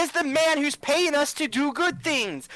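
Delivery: high, slow, over-patient explanation; exasperated explaining. Acted. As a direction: spell it out slowly for someone naive. Player explains morality to naive sword.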